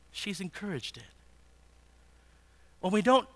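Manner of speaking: ordinary talk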